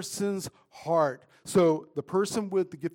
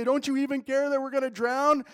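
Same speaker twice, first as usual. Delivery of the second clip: high, louder frightened cry; panicked plea; 8 semitones higher, 4 dB louder than usual. Acted. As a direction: beg like you're sinking. Disciples panicking, waking Jesus.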